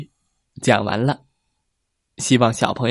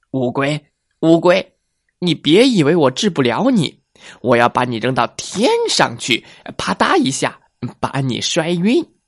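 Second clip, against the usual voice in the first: menacing fox; 2 semitones higher than usual. Acted. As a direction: growl out the fox's threat. Fox threatens the tortoise.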